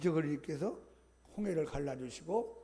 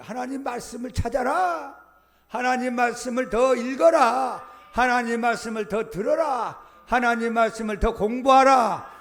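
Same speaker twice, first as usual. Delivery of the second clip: louder, rising exhortation; preaching shout; 4 semitones higher, 11 dB louder than usual. Real, not acted. Pastor urging congregation to read the Word, shouting. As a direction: call out each command from the pulpit, building.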